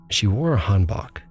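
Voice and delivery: ordinary delivery, nothing marked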